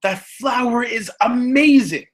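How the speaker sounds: put-on high-pitched wonder of a little kid; amazed young child. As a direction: gush like an awestruck small child. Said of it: "the child will turn and say".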